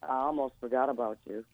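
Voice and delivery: soft, husky and intimate; sultry. Shown as a demonstration. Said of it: "It sounds sexy".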